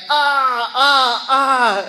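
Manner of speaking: 高声痛叫夹着笑，半开玩笑; high pained cries, half laughing. Retold or imitated. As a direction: cry out in pain, then laugh at yourself. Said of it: "I was like".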